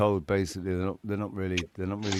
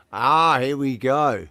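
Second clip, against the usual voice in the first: slow, louder mock stage announcement; announcer welcome; 7 dB louder, slower than usual. Host introducing Kieran to the stage, slow and louder, announcer-style. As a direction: bring the guest on like a show host.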